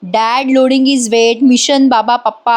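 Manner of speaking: normal talking voice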